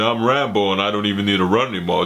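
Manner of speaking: put-on tough-guy mumble; Rambo. Reviewer mocking an unstoppable hero, tough-guy impression. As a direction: say it like an unbothered action hero. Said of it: "He's just like".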